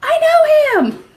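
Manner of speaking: high loud yell of recognition at the TV; excited yell. Retold or imitated. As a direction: yell it like a starstruck fan. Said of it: "I was yelling in the house".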